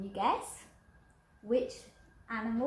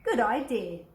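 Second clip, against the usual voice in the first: louder hearty agreement; big skeleton agreeing; 7 dB louder than usual. Acted. Big skeleton approving the little one's plan, louder. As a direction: boom out your approval. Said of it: "the big skeleton said".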